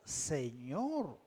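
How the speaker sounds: imitación de sumisión, voz baja y suave; sumiso. Retold or imitated. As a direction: dilo grave y quedo, rindiéndote a su autoridad. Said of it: "Se estaba sometiendo a su autoridad". (sumiso, en tono bajo y apagado)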